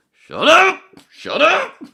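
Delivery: высокий громкий крик, дважды; крикни, обрывая чужую болтовню; mock yell. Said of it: "he just keeps going".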